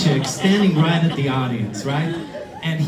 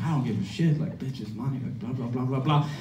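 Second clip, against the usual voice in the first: soft, dismissive blah-blah ramble; mock rant; 7 dB quieter than usual. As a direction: run on softly as if talking nonstop. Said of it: "still in his crazy-ass rant".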